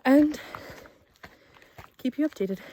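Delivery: ordinary talk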